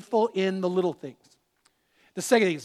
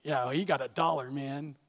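a lightly voiced, lower, dismissive tempter; voice of mammon; 6 semitones lower, 6 dB quieter than usual. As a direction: lower and softer, brushing it off as mammon. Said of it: "Mammon would say".

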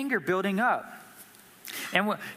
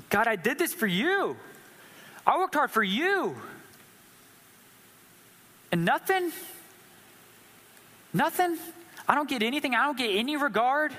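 high, rising indignant cry; climbing outrage, peaking on nothing; angry at God; 6 semitones higher than usual. Retold or imitated. Cain crying out to God that he gets nothing, rising outrage. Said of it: "Man, he's angry".

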